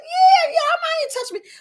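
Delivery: very high, loud mimicked wife; high-pitched retold reply. Wife answering the speaker's request to touch her, high-pitched imitation.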